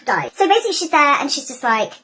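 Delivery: ordinary delivery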